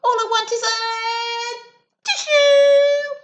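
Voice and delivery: high, loud sing-song wail for a tissue; wailing wolf. Acted. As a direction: howl it like a sad sick wolf.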